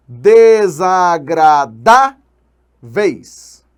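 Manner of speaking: Desagradáveis very slow and loud, syllable by syllable, DÁ punched; loud, stretched Desagradáveis with a strong next-to-last syllable; stressed syllable demo. Shown as a demonstration. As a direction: break Desagradáveis into syllables and hit DÁ. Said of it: "sílaba tônica colocada na penúltima".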